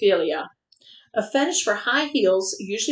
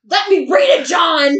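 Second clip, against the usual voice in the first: playful shout; 5 semitones higher, 8 dB louder than usual. Real, not acted.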